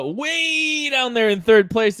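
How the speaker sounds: high, loud, drawn-out "way"; gloating announcement. Real, not acted. Gloating announcement of loser's place.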